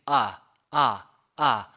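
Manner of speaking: 아 sounded halfway between 아 and 에; in-between vowel. Shown as a demonstration. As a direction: say 아 leaning toward 에, as separate demos. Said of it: "아도 아니고 에도 아닌". (아를 아와 에의 중간 소리로)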